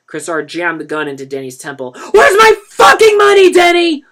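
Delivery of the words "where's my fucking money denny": much higher, much louder scream; a dealer screaming over and over. A narrator's reading. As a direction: scream it with all your rage. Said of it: "He screamed again".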